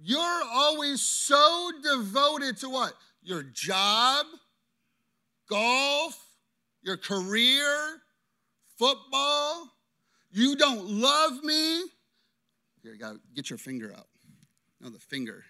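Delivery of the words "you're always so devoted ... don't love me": higher, slowed accusing wife; wife accusing her husband in a skit, raised and slow; wife's complaint. Acted. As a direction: list his distractions like a hurt wife. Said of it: "Wife goes".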